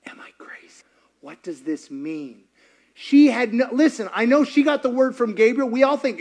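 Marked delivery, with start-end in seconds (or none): 0.1-2.5 barely voiced worried question; whispered self-doubt. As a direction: whisper it to yourself. Voicing Mary's private doubt.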